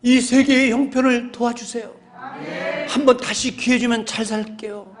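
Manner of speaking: pleading prayer. Pretend. Believers pleading with God in prayer.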